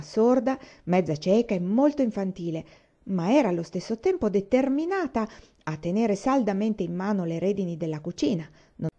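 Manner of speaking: ordinary delivery, nothing marked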